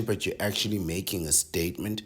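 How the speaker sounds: ordinary talk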